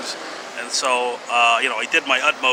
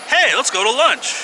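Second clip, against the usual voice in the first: chirpy lady; 9 semitones higher, 3 dB louder than usual. Retold or imitated. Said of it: "she's like".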